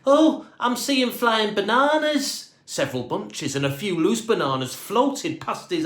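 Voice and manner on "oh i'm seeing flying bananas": dazed wonder. A narrator's reading. Dave dazed in weightlessness.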